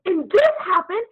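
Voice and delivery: high, loud excited retelling. Retold or imitated. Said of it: "raising my voice to be heard".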